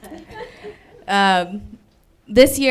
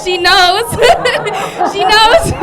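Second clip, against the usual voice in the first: loud, high and laughing; startled glee at a sudden noise; excited laughing outburst; 11 semitones higher, 12 dB louder, slower than usual. Real, not acted. Comic to the audience about the ghost, loud laughing glee. Reacting to a sound in the room.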